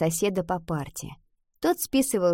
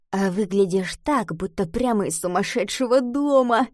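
ordinary delivery, nothing marked

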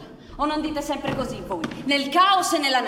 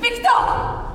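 calling out; 9 semitones higher, 6 dB louder than usual. Acted. Calling husband from another room.

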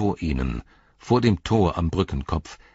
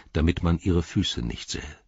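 ordinary delivery, nothing marked

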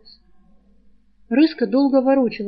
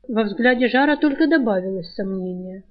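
ordinary delivery, nothing marked

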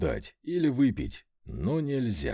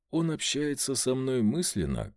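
plain reading voice

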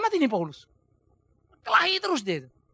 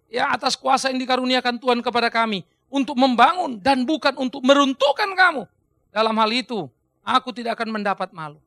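declaimed scripture; 6 dB louder than usual. Acted. Preacher quoting Paul's letter to the congregation, forceful declamation. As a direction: proclaim it forcefully, settling toward the end.

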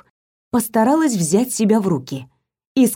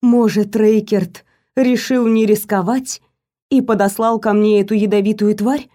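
plain reading voice

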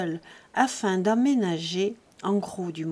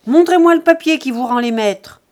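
loud, higher, challenging shout; angry demand; 4 semitones higher, 11 dB louder than usual. A narrator's reading. Jean challenging Buteau's right to the house, shouting. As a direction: demand the proof furiously.

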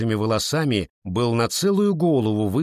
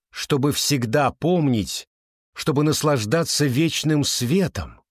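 solemn speech; 3 semitones higher than usual. Acted. (торжественная речь)

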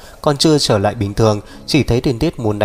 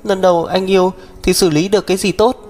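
put-on higher girlish voice, firm; young woman's voice; 8 semitones higher than usual. Sister telling her brother to stay out, stubborn girl voice. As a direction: speak up for herself, stubborn.

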